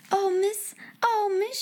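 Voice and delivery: high, soft, overwhelmed little gasp; gasped exclamation. A narrator's reading. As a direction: gasp it lightly, pitched well up. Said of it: "she gasped".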